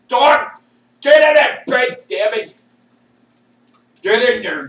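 loud, high angry shout at the dog; yelling at dog. Real, not acted. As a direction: bark orders at the dog to get in bed.

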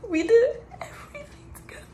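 breaking down in tears mid-sentence; sobbing while remembering her best friend; crying. Real, not acted. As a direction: voice cracking high into a sob.

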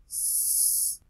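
S as a long, unvoiced sss; letter sound. Shown as a demonstration. Teacher models the letter S, sustained sss sound.

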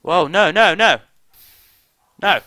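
high, loud cries of alarm; panicked yelling. Real, not acted. Player watching it go wrong, panicked shouting. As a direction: yell each no in a panic.

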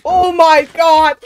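slow, loud, high exclamation; excited outburst. Real, not acted. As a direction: stretch it out in disbelief. Reaction to a lucky find.

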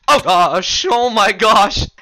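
high loud startled oh gosh; panicked shout of surprise; shocked yelp. Real, not acted.

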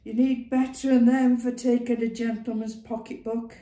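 lightly voiced old Northern woman; brisk, knowing old woman. A narrator's reading. Ma Duckworth voice.

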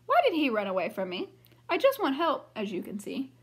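lively read-aloud storybook voice; reading to children. A narrator's reading. Teacher reading a picture book to the class, lively. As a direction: read it brightly for young listeners.

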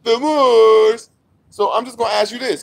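normal talking voice

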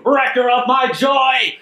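slow, high, theatrical cry; dramatic accusation. Retold or imitated. Wendy storming out on Kimmy, hammed-up wail. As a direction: hurl the line like a wronged diva. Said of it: "she storms out".

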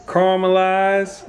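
Caramelize drawn out as "CAAR-muh-lize"; emphatic pronunciation. Shown as a demonstration. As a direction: stretch out Caramelize with an opening "car". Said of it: "Y'all heard what I said".

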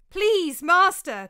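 high, loud terrified plea; frantic cry for help; pleading cry. A narrator's reading. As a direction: beg for mercy in panic. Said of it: "cried Ligdamus".

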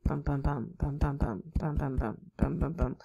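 "bum, bum, bum" in the Kentucky rhythm, ken-TUCK-y; soft rhythmic bums, stress on the middle beat; S3 gallop beat. Shown as a demonstration. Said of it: "That's what an S three would sound like".

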